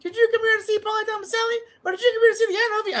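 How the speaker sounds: put-on very high, fast little-kid promo; rushing child. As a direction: imitate a nervous four-year-old racing through lines. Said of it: "You're rushing".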